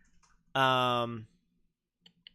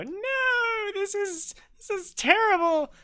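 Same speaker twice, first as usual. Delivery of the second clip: mock whine; 4 dB louder, slower than usual. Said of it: "and I go".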